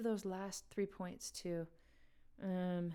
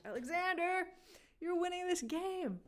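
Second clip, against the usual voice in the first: excited; 10 semitones higher, 4 dB louder than usual. Real, not acted. Excited host praising winner.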